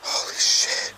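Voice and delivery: breathless, startled whisper; shocked whisper. Real, not acted. Right after the loud crash.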